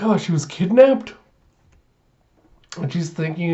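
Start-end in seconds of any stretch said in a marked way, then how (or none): 0.0-1.1 high-pitched mock horror; alarmed realisation. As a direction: act a worried guardian realising the worst. Yor's dreadful realisation.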